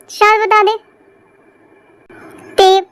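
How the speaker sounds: ordinary talk, nothing marked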